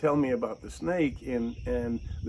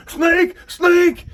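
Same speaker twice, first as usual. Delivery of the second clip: very high, loud shrieking snake; panicked yell; 15 dB louder than usual. Retold or imitated. Terrified men shout about the snake to brother, shrieking. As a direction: scream it like the ground is lava.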